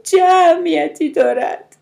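high, loud, drawn-out wail; tearful. A narrator's reading. Mother in tears challenging her sister, wailing. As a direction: cry it out slowly through tears. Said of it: "مادر اشک ریزان پرسید".

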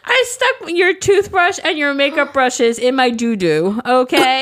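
loud, high gloating rant; mock yelling. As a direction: yell it in her face, laughing. Imagined confrontation yelled at Sasha.

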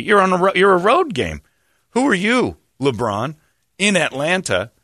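high-pitched, incredulous challenge; mock-confront a star player; indignant rebuke. Rebuking LeBron over a heckler.

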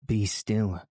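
plain reading voice

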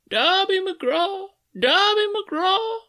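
very high, slow, drawn-out phantom wail; ghostly wail. Acted. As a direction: wail the name like a distant ghost. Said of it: "it wailed".

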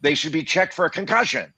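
high-pitched mock outrage; mock ranting. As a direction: act out an incredulous rant. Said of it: "they're not shouted".

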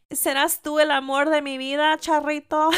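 loud, high mock lovestruck plea; playful swooning. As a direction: gush at a handsome charro, hammed up. Playfully swooning over the charros.

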